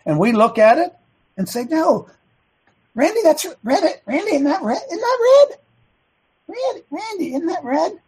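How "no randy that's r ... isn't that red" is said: high, rising plea, insisting it's red; mock pleading with Randy. Coach mock-pleading with Randy to agree it's red, high and insistent. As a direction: coach begging a player to agree, much higher.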